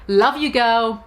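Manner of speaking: ordinary delivery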